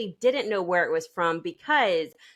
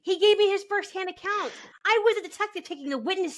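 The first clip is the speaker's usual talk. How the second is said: mock indignant; 9 semitones higher than usual. Mock-offended rant, imitating.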